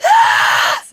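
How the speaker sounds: very high, loud scream; warning ah ah ah. Retold or imitated. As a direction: shriek out the stress.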